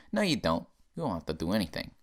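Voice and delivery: usual speaking voice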